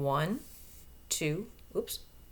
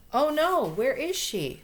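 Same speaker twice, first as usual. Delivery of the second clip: higher, louder oh no; mock alarm; 7 semitones higher, 5 dB louder than usual. Reader to children, mock alarm at a missing monkey. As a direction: play up the shock for the kids.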